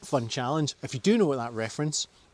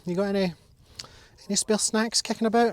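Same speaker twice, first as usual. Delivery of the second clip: voicing the dog; 7 semitones higher, 5 dB louder than usual. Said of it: "He's like".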